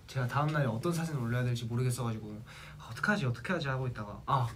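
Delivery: usual speaking voice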